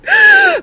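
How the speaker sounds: very high, loud laughing fit; loud cackle. Real, not acted. Host cracking up at own joke, loud high cackle.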